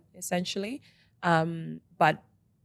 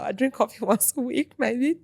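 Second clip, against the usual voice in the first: laughing; 7 semitones higher than usual. Real, not acted. Laughs while admitting coffee habit.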